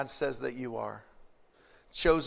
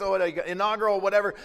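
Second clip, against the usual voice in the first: normal talking voice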